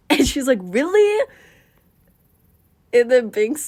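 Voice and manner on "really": high, loud, rising question; surprised. Acted. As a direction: pipe up with eager surprise. Acting girl's surprised reply.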